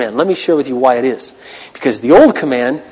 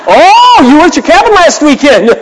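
mock judgmental pastor; 9 semitones higher, 7 dB louder than usual. Mocking a judging pastor's thought.

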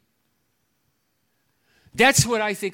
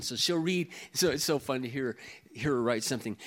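usual speaking voice

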